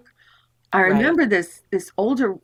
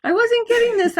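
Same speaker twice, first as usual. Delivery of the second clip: exasperated high; 14 semitones higher than usual. Real, not acted. Exasperated telling.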